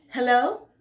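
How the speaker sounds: high, loud rising hello; questioning phone answer. A narrator's reading. Answers phone, rolls eyes.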